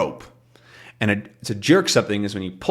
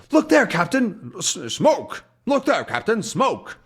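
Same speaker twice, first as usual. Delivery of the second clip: much higher calling sailor; 10 semitones higher than usual. Retold or imitated. Sailors alert Haddock to smoke, much higher calling. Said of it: "they point out there".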